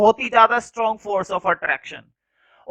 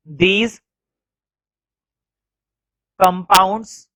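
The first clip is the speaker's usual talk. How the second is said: word by word, very slow, with long pauses; slow classroom dictation; 2 semitones lower, much slower than usual. Shown as a demonstration. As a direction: dictate each word so the class can write it down. Teacher dictating notes slowly.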